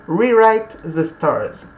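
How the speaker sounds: slow, careful English accent on the title; French speaker trying an English accent; a self-conscious English accent, said slowly; English accent attempt. Real, not acted. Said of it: "mon accent anglais n'est toujours pas bon".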